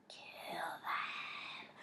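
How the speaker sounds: very soft, drawn-out whisper; menacing whisper. A narrator's reading. Voice in Zach's head urging murder, whispering. Inner voice urges killing.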